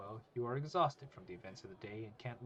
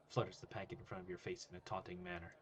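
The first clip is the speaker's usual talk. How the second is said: usual speaking voice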